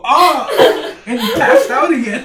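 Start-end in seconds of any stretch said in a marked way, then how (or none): 0.0-0.5 sudden loud yelp of pain, pitched high. Retold or imitated. Friend woken by a kick to the face, loud pained yelp. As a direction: yelp like you just took a kick to the face. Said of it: "you just hear him go".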